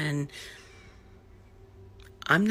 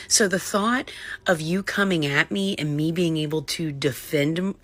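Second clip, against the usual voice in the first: usual speaking voice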